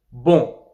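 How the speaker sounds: "bon" ending on nasal o, n silent; nasal vowel. Shown as a demonstration. Teacher giving nasal example, "bon" with silent n. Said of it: "la voyelle devient nasale".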